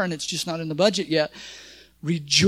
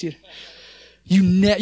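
ordinary talk

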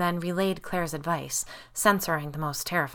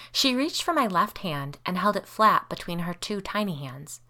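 plain reading voice

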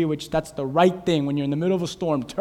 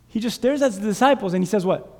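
ordinary delivery

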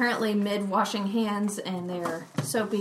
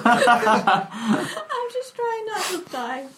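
slow, high words through laughter; can barely speak for laughing; laughing helplessly; 10 semitones higher, 7 dB louder, much slower than usual. Real, not acted. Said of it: "Now [name]'s hyperventilating".

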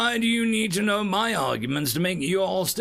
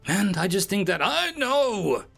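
raised, higher interrupted protest; mild yell; 10 semitones higher, 4 dB louder than usual. A narrator's reading. Observer finally cutting in on his assistant, mild yell. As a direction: lift the voice into a moderate yell. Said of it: "I finally yell".

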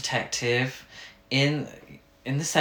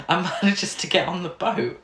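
ordinary delivery

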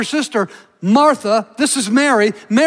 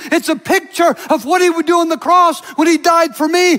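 higher and driving, ringing peaks; full-force preaching; 6 semitones higher than usual. Acted. Preacher proclaiming Jesus absorbs our condemnation, full force. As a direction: preach it out, building with passion.

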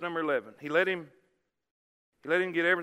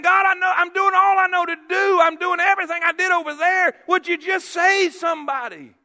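loud, high, exasperated prayer; frustrated plea; 10 semitones higher, 10 dB louder than usual. Retold or imitated. Preacher reliving his honest prayer to God, frustrated plea. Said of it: "I mean, I was so frustrated".

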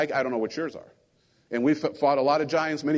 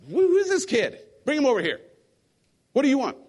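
higher-pitched exasperated demand; mock annoyance; 7 semitones higher than usual. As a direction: lightly act out an irritated summons. Saul's side reacts to annoying kid.

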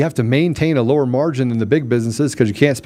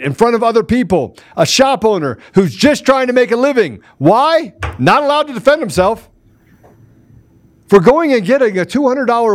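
raised, heated retelling; outraged; 9 semitones higher than usual. Real, not acted. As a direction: let the outrage push the pitch up.

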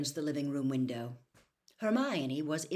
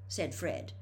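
usual speaking voice

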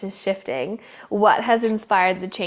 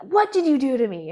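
retold indignant question, higher pitched; mock outraged client; 5 semitones higher than usual. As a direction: act out an accusing client, half in earnest. Retold angry client confronts therapist.

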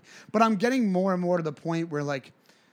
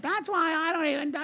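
lightly voiced drunk woman, pitched high; high-pitched mock drunk babble; drunken woman; 10 semitones higher, 3 dB quieter than usual. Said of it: "drunkenly doing whatever".

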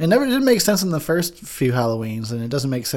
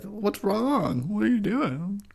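high, softer fussing voice; mock concern; 7 semitones higher, 5 dB quieter, much slower than usual. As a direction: imitate an oversweet worried friend. Mimicking her misplaced concern.

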